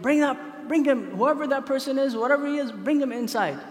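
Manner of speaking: high, urgent ruler's order; retold yell. Pharaoh orders guards to fetch the laughing stranger, mock yell. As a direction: call out a command, half-yelled. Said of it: "So right away he yelled".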